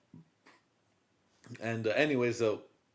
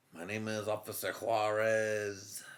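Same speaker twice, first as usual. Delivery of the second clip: mock Mexican accent; 4 semitones lower, 8 dB quieter than usual. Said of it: "Mexican, no lie".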